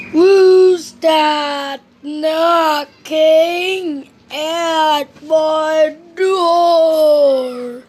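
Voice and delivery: very slow, loud, drawn-out call; sleepy slowpoke calling downstairs; Mister Slow calling. Acted. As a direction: call down the stairs at a crawl. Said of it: "he called downstairs".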